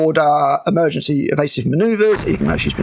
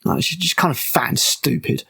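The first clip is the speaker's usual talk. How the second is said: ordinary delivery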